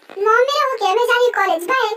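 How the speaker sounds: high, loud parting shout to mom; hurried goodbye. Acted. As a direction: call out while rushing off. Daughter hurriedly leaves for college.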